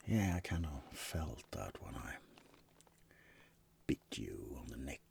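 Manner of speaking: quiet, soft intimate whisper. Acted. Vampire whispering to roommate he bit them. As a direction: confess the bite in a whisper.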